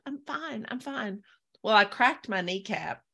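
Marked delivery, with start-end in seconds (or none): 0.1-1.2 small, high, hushed voice; brave "I'm fine". Retold or imitated. Contestant brushing off cameraman's concern, high and soft. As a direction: play down the hurt, softly and higher. Reassuring cameraman while nearly crying.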